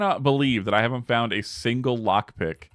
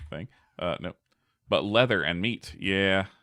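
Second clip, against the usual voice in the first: usual speaking voice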